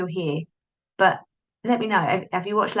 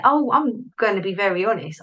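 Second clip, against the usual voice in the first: normal talking voice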